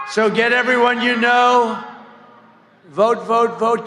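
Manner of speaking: loud rally call to vote; rally shout. Real, not acted. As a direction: belt it out to the crowd.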